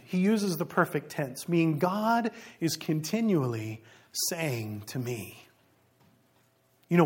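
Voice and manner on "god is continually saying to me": slow emphasis. Real, not acted. Preacher explaining the Greek tense, slowing right down. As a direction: drag it out to land the point.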